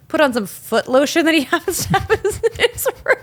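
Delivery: words dissolving into real laughter; laughing while talking. Real, not acted. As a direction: voice rising high into laughter. Breaks into laughter mid-sentence.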